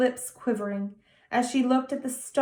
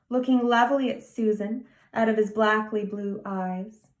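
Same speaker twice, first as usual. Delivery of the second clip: ordinary delivery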